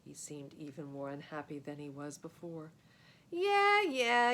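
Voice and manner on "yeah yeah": high, loud yell of taunting; cocky kid yelling a taunt. A narrator's reading. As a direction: shout it back at him. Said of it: "yelled Fred".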